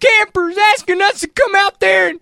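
high, excited movie-character voice; put-on Midwestern dude, high voice; That Thing You Do dude. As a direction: pitch it up like an eager bandmate. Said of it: "That is dude from That Thing".

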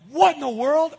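high, loud exclamation; startled as the wall cracks; shocked. Retold or imitated. You on the cracking wall, shocked and loud. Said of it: "You're like".

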